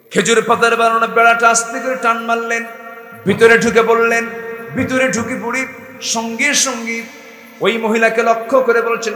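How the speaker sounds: loud, high preaching build; storytelling preacher at full volume; fiery sermon narration. Acted.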